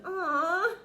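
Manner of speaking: high, loud whining complaint; whiny knight moaning. Acted. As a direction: moan about his father. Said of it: "Sir George moaned".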